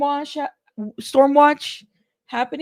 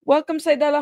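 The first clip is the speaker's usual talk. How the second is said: normal talking voice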